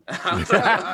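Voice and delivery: laughing through the words. Real, not acted. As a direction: breaks into laughter mid-story. Laughing while retelling.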